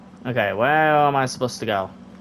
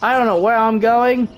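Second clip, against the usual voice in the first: scared horror game streamer; 8 semitones higher than usual. Real, not acted. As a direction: panic while running from danger. Fleeing monster in game.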